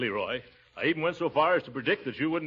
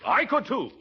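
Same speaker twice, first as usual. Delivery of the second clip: indignant high retort; 8 semitones higher than usual. Acted.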